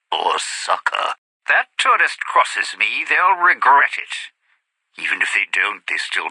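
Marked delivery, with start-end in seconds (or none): 1.5-4.3 raised, forceful, menacing voice; vengeful outburst at full volume; loud threat. Acted. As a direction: throw out the threat loud and high. Threat against a tourist.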